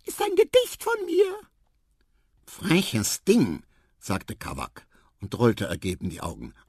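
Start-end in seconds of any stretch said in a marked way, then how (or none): 0.0-1.4 very high, loud little mouse voice; squeaky mouse. A narrator's reading. Mouse character speaks in story. 2.5-3.6 higher, mildly cross rebuke; indignant scolding. A narrator's reading. Marabou scolds the mouse.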